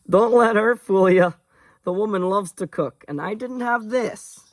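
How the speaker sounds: high-pitched, lilting put-on character voice; teasing Connor. Connor warning the guests about the cook's food, teasing.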